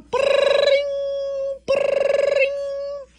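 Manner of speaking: high trilled ring; phone ringing. Acted. Mama llama hears the phone, trilled ringing. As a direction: roll it like an old telephone bell. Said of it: "here's the phone".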